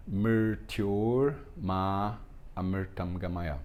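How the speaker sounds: Mrityor said as Mer-tyor, slow and even; Sanskrit pronunciation. Shown as a demonstration. Teacher models the third mantra line slowly. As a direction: chant Mrityor Ma Amritam Gamaya slowly, vocalic r as er. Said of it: "É assim que se pronuncia".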